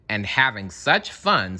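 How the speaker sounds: ordinary delivery, nothing marked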